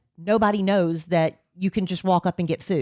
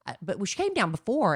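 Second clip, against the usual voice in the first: normal talking voice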